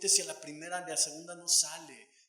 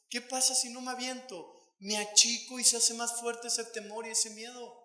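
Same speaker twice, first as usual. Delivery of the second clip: high, loud impassioned preaching; pleading sermon climax; fervent preaching; 7 semitones higher, 5 dB louder than usual. Acted. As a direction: preach it with rising fire. Preacher rises to full force.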